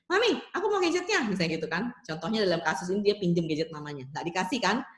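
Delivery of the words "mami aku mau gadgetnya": put-on high-pitched child's demand; acted little-kid voice; child asking mum. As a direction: ask like a kid wanting something from mum. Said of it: "tadi saya bilang ini".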